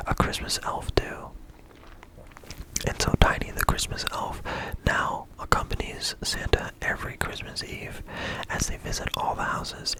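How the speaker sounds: plain reading voice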